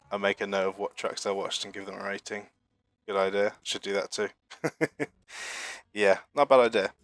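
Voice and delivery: ordinary talk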